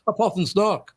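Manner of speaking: put-on high Irish kid's "puff and snock"; imitated Irish accent. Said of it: "That accent is perfect".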